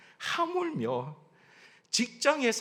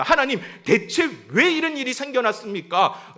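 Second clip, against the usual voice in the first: high, loud protesting prayer; questioning God; 6 semitones higher, 5 dB louder than usual. Acted. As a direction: demand to know why from God. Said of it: "하나님 앞에 물어야 합니다".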